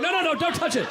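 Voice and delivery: high, rushed mock panic; hammed-up fearful no no no; panicked refusal. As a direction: beg them not to touch it.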